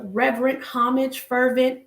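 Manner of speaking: ordinary talk, nothing marked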